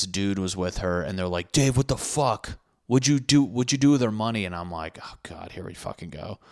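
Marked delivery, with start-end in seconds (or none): 1.5-4.4 higher, louder mock accusation; imitating a frantic confrontation; accusing yell. Upset friends confront him at door. 5.0-6.4 much softer, resigned under the breath; a tired here we go; weary mutter. Retold or imitated. Exasperated reaction to accusation.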